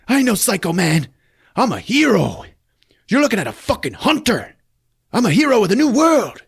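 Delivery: high, loud ranting thug voice; mock villain yell. Gunman yelling at hostages, high mock shout. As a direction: screech it like a crazed gunman.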